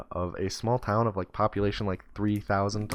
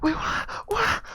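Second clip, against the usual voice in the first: put-on very high, bewildered child's "wait, what?"; confused little kid; slower than usual. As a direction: squeak it out like a lost five-year-old. Kid reacts to being read his rights.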